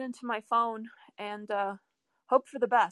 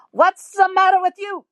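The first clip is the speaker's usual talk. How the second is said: put-on loud, much higher Italian-accented scolding; Italian harangue; 9 semitones higher, 11 dB louder than usual. Grandpa scolding his teenage son, loud Italian harangue. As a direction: bark it like an Italian dad fed up with his kid. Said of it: "give him this Italian harangue".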